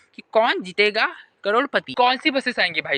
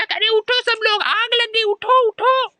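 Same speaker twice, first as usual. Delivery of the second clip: mock fire alarm shout; 12 semitones higher, slower than usual. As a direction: yell at the sleepers to get up. Waking everyone, fire alarm. (घबराहट में ज़ोर से चिल्लाना)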